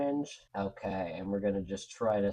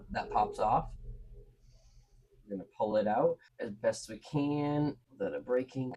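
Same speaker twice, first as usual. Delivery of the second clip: ordinary talk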